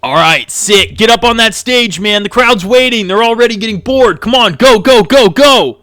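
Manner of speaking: high, very loud hurrying; urgent hype shout. Acted. Organiser hurrying the group onto the stage, urgent shouting. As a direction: shout them onstage, building to rapid-fire gos.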